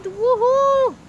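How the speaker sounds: loud happy whoop; excited cheer. Acted. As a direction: throw a party cheer. Cheers for the Christmas party.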